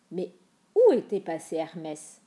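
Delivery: ordinary delivery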